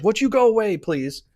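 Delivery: high, loud shooing plea to an unwanted card; sudden loud go-away at the card; exasperated plea. Real, not acted. Reader yells at the unwanted tarot card, exasperated.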